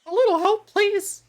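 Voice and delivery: very high, loud squeaky plea; squeaky huntress attempt. Shown as a demonstration. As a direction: overshoot into a cartoonish squeak.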